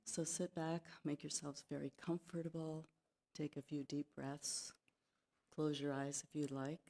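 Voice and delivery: soft, soothing meditation lead-in; gentle hushed invitation, trailing into a breath on the last word; guided meditation. Real, not acted. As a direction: ease listeners into relaxing, softly, with pauses. Settles listeners into the meditation.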